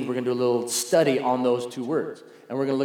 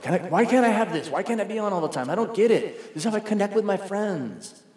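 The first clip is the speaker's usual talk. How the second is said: lightly voiced complaining kid, higher and quicker; mock a child pleading for screen time; imitating whiny kids; 7 semitones higher than usual. Kids complaining about social media.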